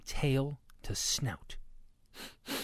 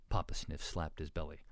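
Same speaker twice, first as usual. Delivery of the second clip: plain reading voice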